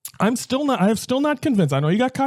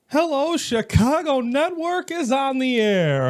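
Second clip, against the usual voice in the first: pitched-up, slow on-air hello; a higher, slower radio-style sign-on; higher, slow opener; 6 semitones higher, slower than usual. Real, not acted. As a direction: open the show high and unhurried. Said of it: "earlier recorded show opener".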